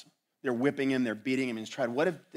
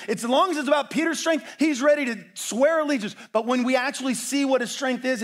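high, loud sermon at full force; impassioned preaching; 12 semitones higher, 7 dB louder than usual. Real, not acted.

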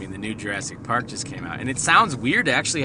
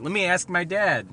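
put-on kid voice; 9 semitones higher, 4 dB louder than usual. Son voiced in story.

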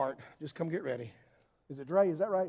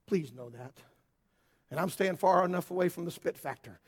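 ordinary talk, nothing marked

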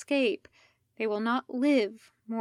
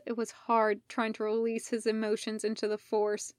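plain reading voice

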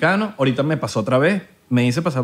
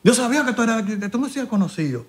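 higher-pitched voice of a keen fan; imitated Dominican driver; 6 semitones higher, 4 dB quieter than usual. Driver telling passenger he follows him, imitated Dominican voice. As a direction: play a Dominican driver recognizing someone, pitched up.

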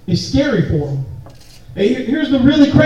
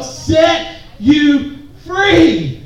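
drawn-out; much slower than usual. Acted. Repeated call and response.

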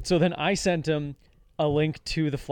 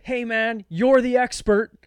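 drawn out and higher, dripping with sarcasm; sarcastic jab, higher and slow at the end; fed-up sarcasm; 8 semitones higher, 6 dB louder, slower than usual. Retold or imitated. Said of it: "'cause I'm getting really fed up".

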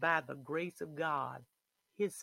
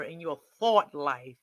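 usual speaking voice

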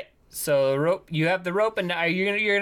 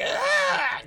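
goblin shriek. Acted. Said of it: "They're like".

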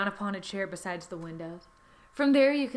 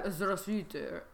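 Russian accent; 3 semitones lower, 7 dB quieter than usual. Shown as a demonstration. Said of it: "throw out a word before".

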